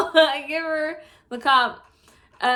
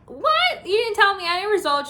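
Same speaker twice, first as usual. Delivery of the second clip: higher and louder, outraged; indignant outrage; 8 semitones higher, 5 dB louder than usual. Retold or imitated. Complaining that the nurse gave no results, outraged and high. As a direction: vent your outrage at the nurse.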